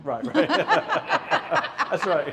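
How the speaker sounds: loud laughing, pitched high; laughing agreement. Real, not acted. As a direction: laugh it off, caught out. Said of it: "Sometimes I was crying because of him".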